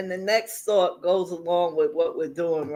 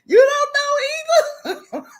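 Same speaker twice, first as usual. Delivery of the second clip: high laughing question; 5 dB louder than usual. Real, not acted. Asking the chat while laughing hard. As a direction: ask it while still cracking up.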